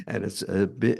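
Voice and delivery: normal talking voice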